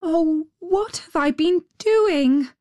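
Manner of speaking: high-pitched, lightly voiced little girl; dismayed little girl. A narrator's reading. Gerda remembers her search for Kay, dismayed.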